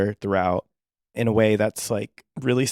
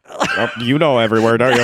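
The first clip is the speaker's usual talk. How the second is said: lightly voiced diva, higher and louder; imitating [name] to crowd; 3 semitones higher, 7 dB louder than usual. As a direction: tease the crowd like a pop star. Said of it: "Talking to the crowd and be like".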